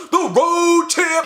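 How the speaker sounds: high, slow, drawn-out announcement; high hammed-up title; mock trailer call. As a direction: sell it like a trailer tagline.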